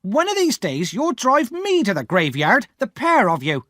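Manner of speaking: high, loud bitter rant; angry exclaiming. Acted. Sister complaining about Pip and Joe, angry rant. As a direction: vent at the boy and husband. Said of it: "my sister exclaimed".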